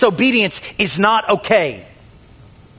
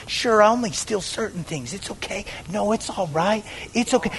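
soft, mock self-soothing; hushed excusing; 8 dB quieter than usual. Sinner excusing petty stealing, hushed and placating. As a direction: drop to a near-whisper to wave it away.

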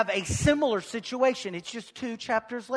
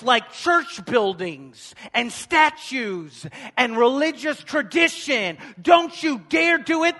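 loud, higher preacher's cry; fiery preaching; 4 semitones higher, 8 dB louder than usual. Acted. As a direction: drive it home to the congregation. Preaching at full force.

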